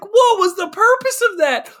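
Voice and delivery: high, incredulous mock complaint; exasperated viewer. Co-host as viewer questioning the pointless death, exasperated. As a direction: wail it in disbelief. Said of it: "you're like".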